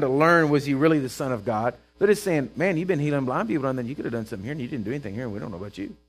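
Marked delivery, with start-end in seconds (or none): 2.6-6.0 complaint sinking lower and much softer; mock grumbling. Preacher voicing bystanders blaming Jesus, fading grumble. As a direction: grumble it away under your breath.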